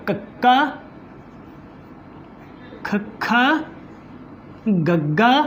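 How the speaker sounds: ਕੱਕਾ, ਖੱਖਾ, ਗੱਗਾ one at a time, long pauses between; teacher demonstrating ਕੱਕਾ, ਖੱਖਾ, ਗੱਗਾ, each stop held then released; pronunciation demo. Shown as a demonstration. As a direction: sound out ਕੱਕਾ, ਖੱਖਾ, ਗੱਗਾ from the back of the throat. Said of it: "ਦੇਖੋ ਇਹ ਧੁਨੀਆਂ ਦਾ ਉਚਾਰਨ ਕਰਦੇ ਹਾਂ".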